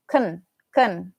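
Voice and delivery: "can" reduced to "kn" with a weak vowel; low, short "can", vowel nearly gone; shortened can. Shown as a demonstration. Teacher isolating the sentence form, reduced can. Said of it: "we shorten it".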